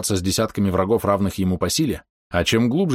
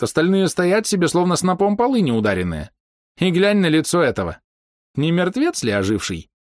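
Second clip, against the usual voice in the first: put-on old man voice; 10 semitones higher than usual. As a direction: chatter like a fussy old man.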